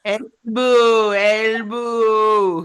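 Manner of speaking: drawn-out mock-Spanish booing; long, held joke boo. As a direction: chant it like a booing crowd. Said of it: "said boo in Spanish".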